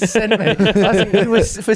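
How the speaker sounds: loud, high, cracking up; laughing. Real, not acted. Retelling his friend's courgette confession, cracking up laughing. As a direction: barely get it out through laughter.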